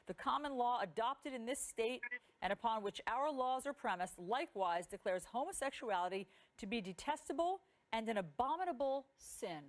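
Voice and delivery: normal talking voice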